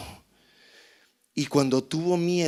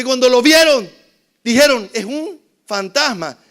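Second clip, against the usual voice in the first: loud, high-pitched sermon storytelling; fiery preaching; 8 semitones higher, 11 dB louder than usual. Acted. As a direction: preach it at full force to the congregation. Preaching at full force.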